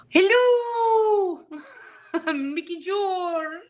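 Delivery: very high, exaggerated Australian drawl; drawn-out, squeaky mock-Aussie greeting; mock Australian accent. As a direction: parody a bad imitation Aussie accent. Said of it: "That's how you sound when you try and imitate me".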